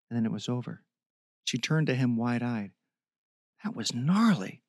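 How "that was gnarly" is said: in wonder. A narrator's reading. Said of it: "she said in wonder".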